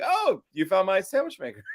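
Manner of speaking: high, lightly voiced coworker; mock coworker. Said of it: "was like".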